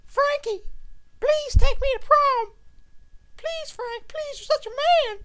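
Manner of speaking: put-on high falsetto girly pleading; swooning ladies. Ladies begging Frank for prom, high falsetto plea. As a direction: beg sweetly in a squeaky girl voice. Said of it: "they were like".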